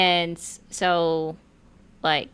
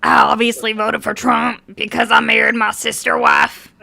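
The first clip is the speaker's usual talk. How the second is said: Trump supporter impression; 4 semitones higher, 7 dB louder than usual. Doing her Trump supporter impression for friends, loud Southern accent. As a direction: belt it in a hammed-up Southern accent. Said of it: "my impression of a Trump supporter".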